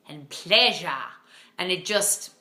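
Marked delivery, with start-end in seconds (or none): 0.3-0.9 mock upper-class British "pleasure"; upper-class British accent. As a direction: spoof a posh British narrator on "pleasure". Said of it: "upper-class British accent".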